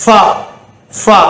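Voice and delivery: ஃபா as a short 'fa' with air; ஃபா with a breath on the f; airy f. Shown as a demonstration. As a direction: blow air through the f of ஃபா. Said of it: "காத்து".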